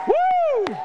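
very high loud woo; high cheering whoop. Real, not acted. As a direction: whoop at the top of the voice. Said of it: "We're gonna cheer".

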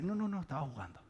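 dismissive denial, dropped in volume; hasty backpedaling. Acted. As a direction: softly wave the alarm away. Delilah backpedals after false alarm.

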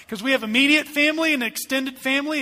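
ordinary talk, nothing marked

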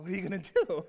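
little brother getting worked up; excited kid. Retold or imitated. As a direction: push it out with excitement. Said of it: "I started getting excited".

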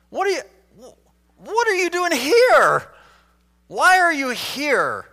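high, incredulous question booming on "here"; stress on HERE. Shown as a demonstration. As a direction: build to a loud, stretched "here". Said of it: "Or how about".

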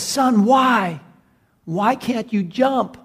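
high, anguished questioning; acted desperate appeal; pleading father. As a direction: plead with a trapped son for an answer.

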